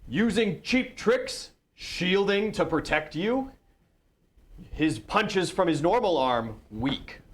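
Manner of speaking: high, drawn-out taunts to the crowd; taunting fighter. Acted. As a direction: present the foe's failings slowly and loudly.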